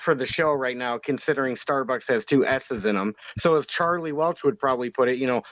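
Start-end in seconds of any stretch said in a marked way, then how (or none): none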